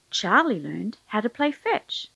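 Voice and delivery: usual speaking voice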